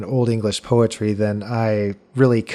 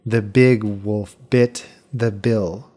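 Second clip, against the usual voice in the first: slow, with "big", "bit" and "bill" stressed, each short I clear; alliteration and vowel demo; slower than usual. Shown as a demonstration. Host reworks the example for listeners, slow with the B words stressed. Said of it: "changed all the vowel sounds to that short I sound".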